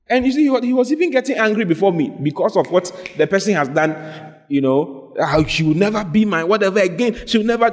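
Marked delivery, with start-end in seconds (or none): none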